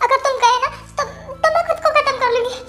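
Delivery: soft, broken sobbing, rushing through the words; sobbing apology. Acted. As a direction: beg forgiveness while weeping quietly. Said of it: "तुम कब से रोए जा रही हो". (सिसकते हुए धीमी माफ़ी)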